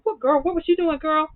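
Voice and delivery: put-on high squealing what girl; nosy disbelief. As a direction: gasp it out wanting the gossip. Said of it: "I was sitting up here like".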